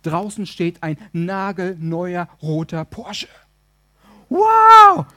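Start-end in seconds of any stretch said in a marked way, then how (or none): 4.3-5.1 high, very loud shout of amazement; explosive, hammed-up wow; amazed wow. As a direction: burst out in acted astonishment. Wife sees the red Porsche.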